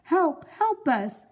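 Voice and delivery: high, slow pleading calls; repeated cries for help. A narrator's reading. Trapped couple begging the firemen from inside, calling for help. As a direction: keep calling out, growing desperate.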